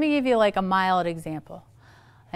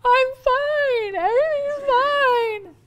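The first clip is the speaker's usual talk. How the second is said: very high, strained fake cheer; falsely fine; 14 semitones higher, 3 dB louder, slower than usual. Parent pretends to kids all is well, high mock-tearful denial. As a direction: squeak it out as an obvious lie. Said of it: "they know we're lying".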